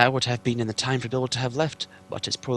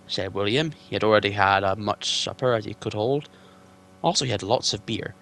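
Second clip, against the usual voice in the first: ordinary delivery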